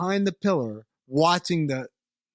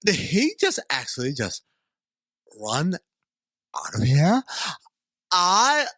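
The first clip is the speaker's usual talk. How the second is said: put-on California Valley accent. Podcaster voicing alien Brax with a mild California Valley accent, stunned. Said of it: "that's your fucking California accent".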